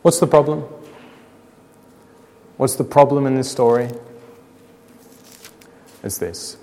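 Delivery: ordinary delivery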